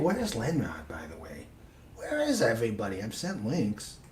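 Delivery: breathy unvoiced whisper. Real, not acted. Host whispering an aside to the co-host, whisper. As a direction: hush it, just air, no voice.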